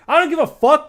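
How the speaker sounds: high, loud outburst; defiant shout. Real, not acted. Snapping at a friend who asks if he's streaming, shouting. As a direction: blow up at the question.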